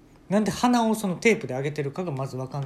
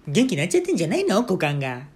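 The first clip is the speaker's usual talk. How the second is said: high, loud, quick teasing mother's voice; ribbing her husband about his crotch, louder; 3 semitones higher, 5 dB louder than usual. Retold or imitated. Mother teasing father at dinner, high and loud. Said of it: "僕の母親がね".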